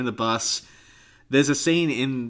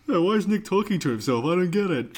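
higher, mocking take on his friend's whiny voice; mock impression of Nick; 9 semitones higher than usual. Teasing his co-host with a high mock Nick voice. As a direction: parrot him back in his own whine. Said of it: "Fuck, you've done it again".

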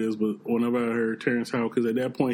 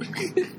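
squealing laugh; high laugh. Real, not acted. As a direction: burst into a high giggle.

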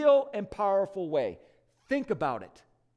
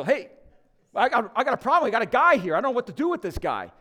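flustered angel calling out; 4 semitones higher, 6 dB louder, faster than usual. Retold or imitated. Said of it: "He calls over the supervising angel".

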